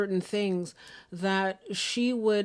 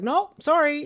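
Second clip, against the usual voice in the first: higher, louder mock refusal; breezy refusal; 6 semitones higher, 6 dB louder than usual. Guides turning down the people they guide, mock refusal. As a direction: lightly act out a brush-off, pitched up.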